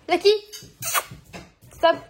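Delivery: sharp, high call to a dog; real command, cutting off mid-talk. Real, not acted. Owner interrupts her video to scold the dog, calling out.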